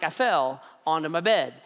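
ordinary delivery

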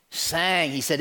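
ordinary talk

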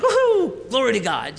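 triumphant whoop. Retold or imitated. Ladder triumph, light finally clicks.

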